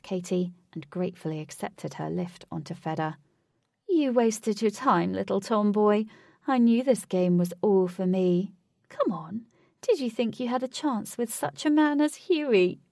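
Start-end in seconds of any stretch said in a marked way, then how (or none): 3.9-12.8 raised, louder mocking taunt. A narrator's reading. Rival to Katie from the horse, taunting. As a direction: gloat over the loser.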